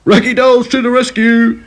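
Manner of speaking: high rallying cry; rally the troops; yelling. A narrator's reading. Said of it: "yelled Back to Front".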